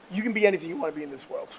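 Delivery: usual speaking voice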